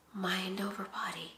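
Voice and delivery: whispered, very soft; hushed motto. Real, not acted. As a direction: drop to a whisper like a private mantra. Self-motto after a long pause.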